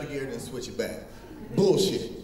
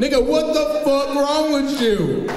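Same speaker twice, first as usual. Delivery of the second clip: slow, loud, high indignant yell; loud outraged shout; 8 semitones higher, 8 dB louder, slower than usual. Acted. Comic yelling at crowd about nonsense, loud outrage. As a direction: bark it out, outraged.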